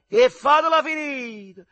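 high, loud cry with Neapolitan lilt; half-Neapolitan shout. A narrator's reading. Soldier with a girl acting tough, half-Neapolitan yell. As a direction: yell it in a semi-Neapolitan drawl. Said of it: "gridò con una calata mezza napoletana".

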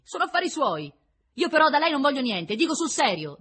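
loud, high, insistent retort; defiant rejection. A narrator's reading. Prisoner refusing the prince's help, defiant. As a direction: shut him out forcefully.

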